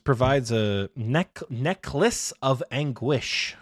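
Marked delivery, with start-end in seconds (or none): 1.5-3.5 Necklace of Anguish drawn out slowly; strange slow pronunciation. Shown as a demonstration. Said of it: "I said that so strangely".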